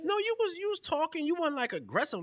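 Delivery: squeaky, playful teasing; high teasing voice. Real, not acted. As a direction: tease him with a high voice. Teasing friend about aggression.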